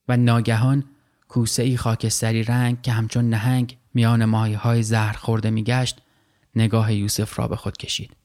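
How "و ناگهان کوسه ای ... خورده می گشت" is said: usual speaking voice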